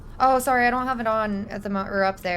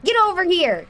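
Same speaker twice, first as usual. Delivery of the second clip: 1 shouted command; 5 semitones higher, 3 dB louder than usual. Pretend. Chasing victims, yelling.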